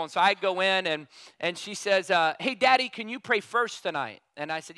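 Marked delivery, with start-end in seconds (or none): 2.4-4.2 lightly voiced sweet little girl; a hint of a small girl's voice; little girl asking. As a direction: ask like a hopeful little daughter. Daughter voiced in story.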